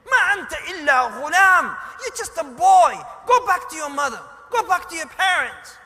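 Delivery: loud, high, forceful rebuke; stern shouted dismissal. Retold or imitated. Man ordering a boy back to his parents, stern shout. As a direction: send him packing at full voice.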